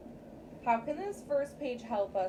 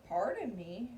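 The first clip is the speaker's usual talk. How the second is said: normal talking voice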